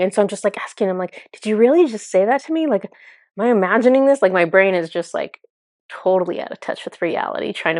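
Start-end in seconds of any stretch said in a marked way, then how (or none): 1.3-4.2 higher, quick disbelieving questions; incredulous. Retold or imitated. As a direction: ask it in retold disbelief. Disbelieving question to her guide.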